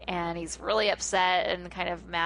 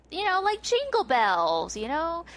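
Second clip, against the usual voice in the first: high-pitched imitated impatience; Lucy, prodding; 9 semitones higher, 4 dB louder than usual. Host voicing a girl unhappy with the piano playing, high mock prodding. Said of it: "she's not into it".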